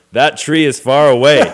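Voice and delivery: loud, high punchline yell. Acted. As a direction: shout it as an exasperated joke payoff. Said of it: "pretty soon I'm yelling".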